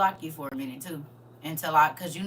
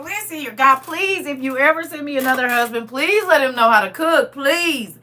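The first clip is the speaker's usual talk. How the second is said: louder, higher, pleading with God; mock prayer; 6 semitones higher, 8 dB louder than usual. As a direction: beg heaven for a husband who cooks.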